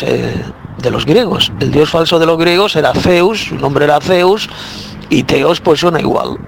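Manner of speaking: normal talking voice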